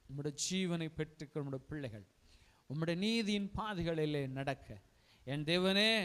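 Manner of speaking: hushed pastoral prayer. Acted. Leads a closing prayer.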